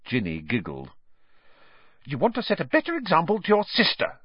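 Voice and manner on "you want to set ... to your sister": high, quick motherly snap; snapped scolding. A narrator's reading. Mother snapping at her twins over their sister, sharp scolding. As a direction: tell them off fast. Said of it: "snapped Missus Weasley".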